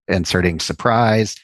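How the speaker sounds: normal talking voice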